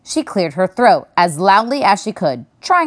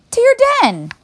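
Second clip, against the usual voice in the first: put-on high girl voice. A narrator's reading. Mona answers.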